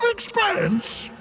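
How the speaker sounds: god affronted by the word; outraged echo. Acted. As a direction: repeat it in disbelief, offended. God repeats word indignantly.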